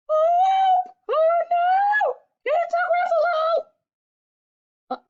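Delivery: high, loud shriek of alarm; shrill-pitched, shouted call for help; panicked cry. Acted. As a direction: cry out in storybook terror. Mouse cries out on meeting monster.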